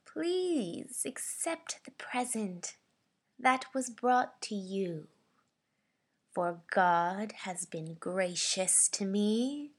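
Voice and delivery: slow, stretched-out menacing voice; sinister, menacing. Shown as a demonstration. Voice actor's second audition read of offering a gift, sinister menace. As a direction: draw each phrase out with threat. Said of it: "this is my sinister slash menacing read".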